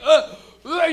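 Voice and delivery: loud hammed-up gagging ugh; mock retching grunts. Coughing smoker retching, hammed-up gagging. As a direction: gag it out loud. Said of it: "you're like".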